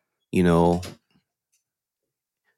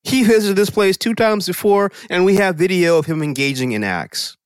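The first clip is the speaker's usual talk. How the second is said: police officer voice; 9 semitones higher than usual. Retold or imitated. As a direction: play a smug officer. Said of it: "Police say".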